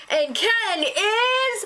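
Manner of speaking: high, very slow, drawn-out verdict; suspenseful game-show host. Acted. Show host announcing the puzzle check result, mock suspense. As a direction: stretch out the reveal, then gasp.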